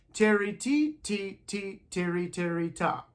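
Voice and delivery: tiri, ti, ta chanted in time; rhythm syllables. Shown as a demonstration. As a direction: chant along with the class.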